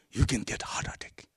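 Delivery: whisper. Acted. Punchline about a broken purchase.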